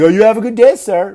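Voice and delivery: loud, high mocking farewell; very sarcastic. Officer sending off the ticketed driver, very sarcastic. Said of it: "very sarcastically".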